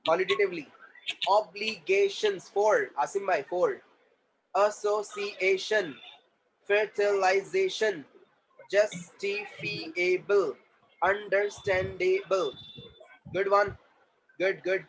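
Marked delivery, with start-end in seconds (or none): 1.3-2.5 very slow, separated syllables. Shown as a demonstration. Teacher modelling syllables for the class, syllable by syllable. 4.5-12.5 very slow, separated syllables. Shown as a demonstration. Teacher modelling syllables for the class, syllable by syllable.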